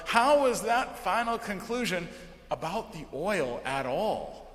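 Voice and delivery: normal talking voice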